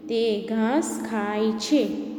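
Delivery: તે ઘાસ ખાય છે read slowly again, ઘાસ leaned on; slow reading aloud. Shown as a demonstration. Teacher repeats the sentence slowly for pupils. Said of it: "વાક્ય વાંચીશું".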